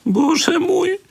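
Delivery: very high tearful wail; sobbing cry. A narrator's reading. Little girl sobs in terror in the cellar. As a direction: sob it out. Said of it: "załkała Halszka".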